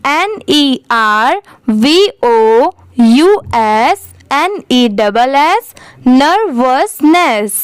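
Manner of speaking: slow spelling, then nervousness in three spaced syllables; slow teacher's model, ending ner-vos-ness for nervousness; repeat-after-me. Shown as a demonstration. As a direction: space out each letter and part of nervousness for learners. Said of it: "मेरे बाद repeat करिए".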